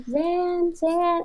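high, soft calling; imitated patient mom's gentle call; so nice mom. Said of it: "your mom will be so nice".

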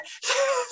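high-pitched burst of laughter; a quick squealing laugh; real laugh. Real, not acted. Laughing at own crazy ride.

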